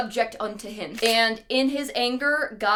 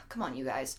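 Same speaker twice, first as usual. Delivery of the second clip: exasperated; 9 semitones lower, 12 dB quieter than usual. Retold or imitated. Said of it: "is like".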